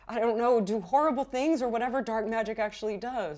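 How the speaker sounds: a nervous beekeeper babbling out his trouble; fretful beekeeper. Acted. GM voices worried beekeeper NPC.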